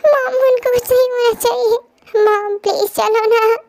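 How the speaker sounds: tearful, begging mom through sobs; crying plea. Acted. Wife crying to mother-in-law to go to hospital, tearful. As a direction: plead through tears, slow at the end. Wife begs after husband's accident.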